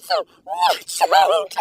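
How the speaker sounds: a loud, slow, stretched-out warning; excited warning. Real, not acted. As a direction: stretch it out with glee. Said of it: "I'm already getting excited".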